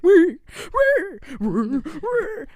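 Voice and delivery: high, loud "wee" noises, drawn out; imitated machine squeal. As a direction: squeal like a whirring toy. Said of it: "he's like".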